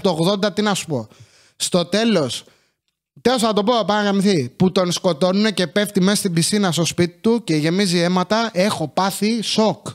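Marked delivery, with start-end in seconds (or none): none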